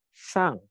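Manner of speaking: Shang starting high and dropping sharply; stamped fourth tone. Shown as a demonstration. Teacher demonstrating the fourth tone, Shang stamped downward. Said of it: "berarti kayak dihentakkan".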